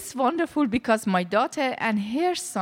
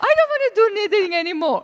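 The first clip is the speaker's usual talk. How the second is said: high, loud and very fast complaint; exasperated outburst; 12 semitones higher, 5 dB louder, much faster than usual. Retold or imitated. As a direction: rush it out, fed up. Fed up with knitting class.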